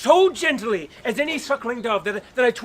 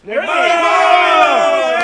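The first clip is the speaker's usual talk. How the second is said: drawn-out loud declaration; slow proud shout; 6 semitones higher, 8 dB louder, much slower than usual. Acted. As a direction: bellow his own name slowly. Bottom proudly answers roll call.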